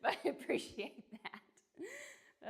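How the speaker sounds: loud laughing self-mockery; retelling a wardrobe mishap, laughing. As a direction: laugh through the line. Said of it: "I was like".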